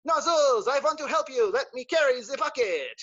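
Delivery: the brave squirrel offers help; eager squirrel voice. Acted. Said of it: "Jean-Claude is like".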